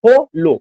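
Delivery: हो लो broken into two loud, high beats; split into syllables. Shown as a demonstration. Teacher showing two syllables, हो लो split apart. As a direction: say हो, then लो, separately. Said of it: "hollow का जब आप उच्चारण".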